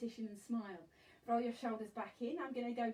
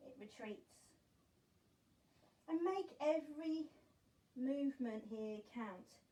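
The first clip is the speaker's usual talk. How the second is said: guided meditation. Acted. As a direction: paint the tide image calmly. Imagery-led calm guidance.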